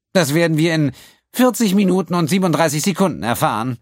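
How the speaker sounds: high, loud, superior; prim accountant. A narrator's reading. The accountant answering Quinn, high and superior. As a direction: correct him coldly.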